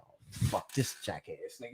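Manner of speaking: low, soft, slow muttered curse. Retold or imitated. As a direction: mutter it under your breath. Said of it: "I'm like".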